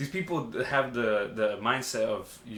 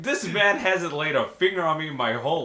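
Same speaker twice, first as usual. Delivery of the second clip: higher, louder and slower protest; incredulous insistence; 5 semitones higher, 5 dB louder than usual. Retold or imitated. Son defending his dad to scared employees, incredulous. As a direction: insist in comic disbelief.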